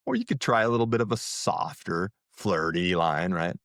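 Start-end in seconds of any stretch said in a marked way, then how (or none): none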